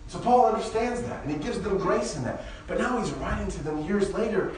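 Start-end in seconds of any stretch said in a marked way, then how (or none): none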